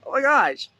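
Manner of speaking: high, loud gasp of surprise; delighted surprise. Retold or imitated. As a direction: squeal it like wonderful news. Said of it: "of just like".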